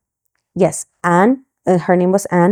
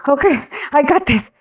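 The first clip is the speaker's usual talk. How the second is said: laughing; 8 semitones higher than usual. Retold or imitated. Laughing reply to boss.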